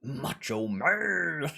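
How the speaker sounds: slow, gravelly-style wrestler growl; Macho Man voice. Retold or imitated. Streamer names his wrestler impression, slow and high. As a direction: drag it out like a wrestling promo. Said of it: "do the Macho Man voice".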